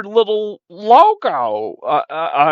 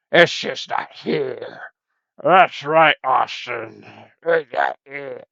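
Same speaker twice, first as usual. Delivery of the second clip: slow, lower strained voice; low put-on voice; 5 semitones lower, 3 dB quieter, slower than usual. Host straining a low voice that drives him nuts. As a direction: push the voice down till it hurts. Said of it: "all that drive my voice nuts".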